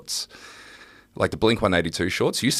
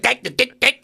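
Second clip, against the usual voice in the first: high staccato South African caricature; mock South African accent; 5 dB louder than usual. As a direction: fire off hard South African consonants, high-pitched. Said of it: "You know, it's just like".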